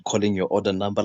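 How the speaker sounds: usual speaking voice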